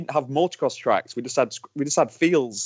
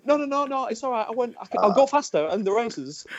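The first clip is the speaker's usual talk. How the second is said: high, hurried, anxious begging; boy begging his angry dad to stay; pleading kid; 10 semitones higher than usual. Retold or imitated. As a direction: promise dad anything to calm him down. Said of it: "And I'd be going".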